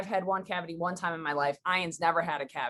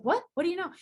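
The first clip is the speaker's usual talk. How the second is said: high-pitched retold bafflement; puzzled, higher-pitched questioning; startled; 10 semitones higher, faster than usual. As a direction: imitate a taken-aback neighbor.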